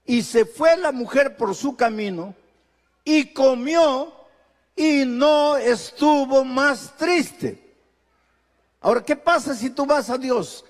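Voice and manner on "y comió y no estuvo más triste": slow emphatic. Acted. Preacher dwelling on Ana's relief.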